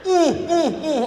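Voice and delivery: high, loud mock-alarm hoots; panicked hooting. Friend in the car sees police, panicked hoots. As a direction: imitate a freaked-out friend hooting. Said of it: "my friend freaks out".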